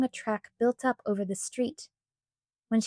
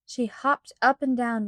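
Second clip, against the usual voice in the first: ordinary delivery, nothing marked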